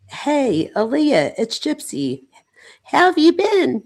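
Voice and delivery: high, loud, bubbly reading of a text; text message voice. Pretend. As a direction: read it like a chirpy texting girl. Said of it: "put on my, my, um, text message voice".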